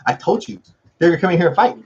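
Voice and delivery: ordinary talk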